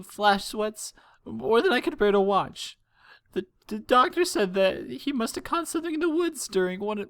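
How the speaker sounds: high, trembling voice of a frightened mare; a worried mother holding back tears; tearful mother. Acted. Frightened mother telling the princesses of her missing son, high and tearful.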